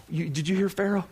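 ordinary delivery

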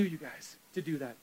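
ordinary talk

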